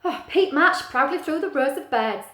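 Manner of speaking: usual speaking voice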